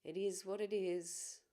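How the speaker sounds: calm, measured guiding voice with long pauses; guided meditation. Acted. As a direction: ease listeners gently inward, leaving space between phrases.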